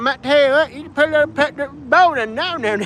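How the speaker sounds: high, mumbled Appalachian twang; Alabama neighbor's accent. Retold or imitated. Imitating his neighbor next door, high hillbilly accent. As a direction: mimic a thick backwoods Alabama accent. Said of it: "he really would talk like that".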